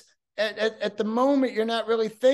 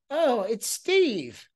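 put-on high, slow sweet old-lady greeting; lightly voiced granny picking up the phone; old grandma voice; 7 semitones higher, much slower than usual. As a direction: play a grandma happily answering her grandson. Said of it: "you're the old lady, grandma".

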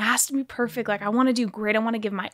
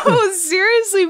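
laughing; 10 semitones higher, 5 dB louder than usual. Real, not acted. Laughing at host's chant.

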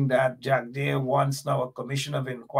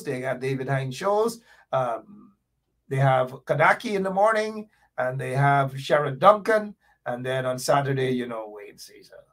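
ordinary talk, nothing marked

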